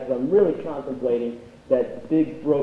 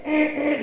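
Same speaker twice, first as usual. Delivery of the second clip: high car-horn honks; imitated car horn; 11 semitones higher than usual. Dad honking in traffic, imitated horn.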